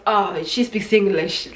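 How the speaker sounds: slow, drawn-out knowing aside; slow knowing remark. Retold or imitated. Greeter noticing she speaks English, slow knowing tone. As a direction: drag it out, sizing her up. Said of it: "like that".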